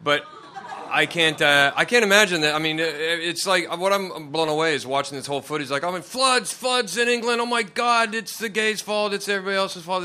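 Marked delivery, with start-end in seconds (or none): 5.9-10.0 higher, hammed-up panicked whinge; pitched-up mock outrage over English floods; mock whining Brit. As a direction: parody the hysterical locals blaming everyone. Mocking whiny English flood reaction.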